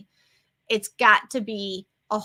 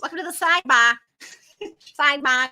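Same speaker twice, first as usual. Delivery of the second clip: loud, higher-pitched Boston impression; thick Boston accent; 4 semitones higher, 4 dB louder, faster than usual. Podcaster imitating a woman's catchphrase, thick Boston accent. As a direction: drop the R with Boston gusto. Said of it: "very thick Boston accent".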